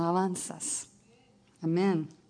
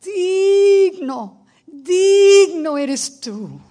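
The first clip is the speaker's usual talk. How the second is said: higher, much louder, slow crying out; crying out worship; 7 semitones higher, 9 dB louder, much slower than usual. Retold or imitated. Preacher voicing the elders before the throne, slow loud cry. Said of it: "se caían en sus rostros y gritaban".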